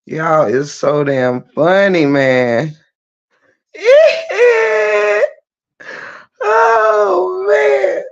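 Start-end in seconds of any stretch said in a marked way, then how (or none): 3.7-8.0 high wailing laugh into words; cracking up. Real, not acted. Host tickled by funny chat, high wailing laughter. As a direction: squeal it out, helpless with laughter. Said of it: "that's why I'm cracking up".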